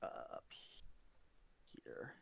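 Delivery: very slow unvoiced whisper. Real, not acted. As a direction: whisper while pointing on map. Whispered aside at map.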